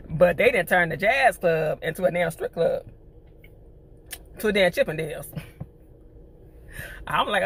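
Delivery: ordinary delivery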